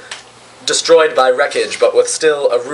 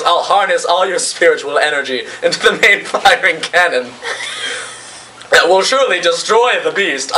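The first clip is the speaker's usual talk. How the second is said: lightly voiced megalomaniac announcing a scheme; a hint of raised-pitch grandiosity; mad villain; 4 semitones higher than usual. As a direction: proclaim the evil plan. Mad captain announces his plan.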